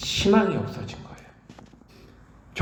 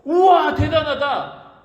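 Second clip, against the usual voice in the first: amazed; 7 semitones higher, slower than usual. Pretend. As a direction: gasp in wonder. Onlookers marvel at miracle.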